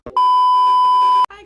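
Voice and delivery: very high, loud squeal of a hello; squeaky high greeting. Acted. Squeaky greeting opens vlog.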